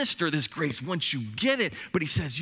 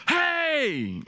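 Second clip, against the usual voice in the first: high, loud call up the stairs; mock-bark at the kid from downstairs; imitated yell; 12 semitones higher, 3 dB louder than usual. Dad yelling up at the daughter to practice, a loud hammed-up call. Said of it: "from downstairs yelling up".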